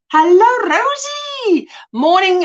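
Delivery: high, loud sing-out hello; excited greeting. Real, not acted. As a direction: call out brightly with the name held high and long. Calling out greeting to arriving viewer.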